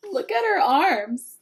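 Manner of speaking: ordinary delivery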